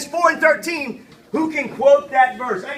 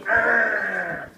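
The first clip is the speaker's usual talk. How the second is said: growl; 5 semitones lower, 4 dB quieter than usual. Shown as a demonstration.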